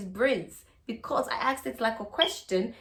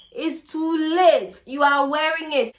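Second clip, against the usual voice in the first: gloating; 4 semitones higher, 5 dB louder than usual. Acted. Gloating at trapped man.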